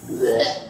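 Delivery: a quick, breathy mock retch with no voice; retching bleh. Drunk friend pushing food away, mock retch. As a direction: fake a gag as if about to throw up. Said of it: "And I'm like".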